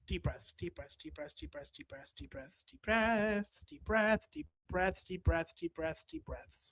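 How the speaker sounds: very soft, low, fading to a whisper; whispered self-calming mantra. Real, not acted. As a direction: talk yourself down under your breath. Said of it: "I'm gonna".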